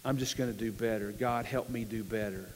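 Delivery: low, soft, private plea; acted quiet prayer. Struggling believer asking God for help, quietly resigned. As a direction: sink into a subdued promise to yourself.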